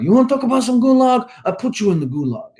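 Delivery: hammed-up Russian accent, pitched high and fast; clumsy, over-the-top Russian accent; bad Russian accent. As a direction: play a mock Russian tough guy in a thick Russian accent. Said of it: "my horrible rendition of a Russian accent".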